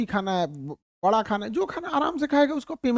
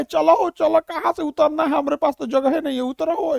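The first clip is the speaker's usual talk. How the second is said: high, pushy shooing off passengers; bossy conductor; 5 semitones higher than usual. Retold or imitated. Conductor shooing passengers off the bus, high and pushy. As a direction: hurry them off like a bus conductor.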